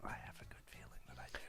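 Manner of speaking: teasing whisper. Real, not acted. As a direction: breathe it out as a secret. Teasing aside.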